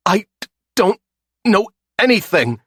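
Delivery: high, loud, stammering insistence; tortured captive insisting he knows nothing, high and panicked; insisting in fear. Acted. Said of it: "he insists".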